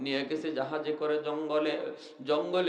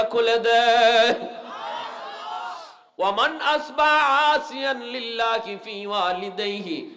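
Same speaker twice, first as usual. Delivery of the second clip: high, ringing chant-like sermon delivery; full-force preaching; 9 semitones higher, 5 dB louder than usual. Acted. Preacher promising paradise for pleasing parents, full-force chanting. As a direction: sing out the scripture and its meaning with fervour.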